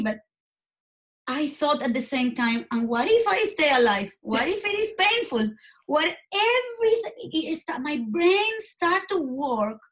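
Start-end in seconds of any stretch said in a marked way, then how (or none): 2.7-7.1 retold thoughts climbing higher and higher in pitch; rising alarm. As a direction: voice her growing panic, rising steeply with each question. Retold panicked thoughts of dying.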